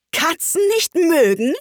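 high, indignant shriek; furious screech. A narrator's reading. Outraged mouse repeats Alice's words, screeching furiously. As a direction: screech the question back in outrage. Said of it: "schrie die Maus mit kreischender, wütender Stimme".

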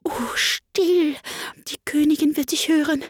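high, hushed warning; anxious rabbit's warning; alarmed shushing. A narrator's reading. Rabbit shushes Alice, fearing the queen.

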